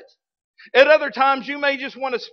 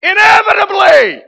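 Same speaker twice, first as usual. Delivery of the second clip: much higher and louder, a big preacher shout; booming shout; 11 semitones higher, 12 dB louder than usual. Acted. Preacher to the congregation, shouting the punchline. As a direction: belt it out for the punchline.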